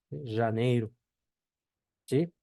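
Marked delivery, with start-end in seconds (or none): 0.4-0.9 janeiro as "zha-NEY-ru"; Portuguese pronunciation demo. Shown as a demonstration. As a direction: buzz the j of janeiro, end it in "ru". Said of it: "en la palabra janeiro".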